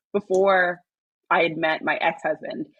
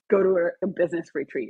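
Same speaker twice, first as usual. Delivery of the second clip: ordinary talk, nothing marked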